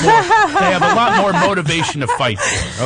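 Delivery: real amused emphasis; excited and laughing. Real, not acted. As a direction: build up as she explains.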